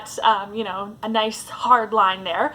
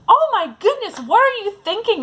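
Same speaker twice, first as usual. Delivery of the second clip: high, loud mock outrage; imitated angry reaction; 10 semitones higher, 4 dB louder than usual. Imagined first reaction to the slate, high mock outrage. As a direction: squeal it, indignant and louder. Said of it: "like, anger".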